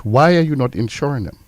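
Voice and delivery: ordinary delivery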